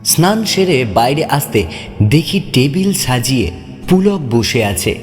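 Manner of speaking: plain reading voice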